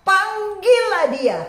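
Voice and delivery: high, slow, ringing quoted command; drawn-out preacher's call; emphatic summons. Retold or imitated.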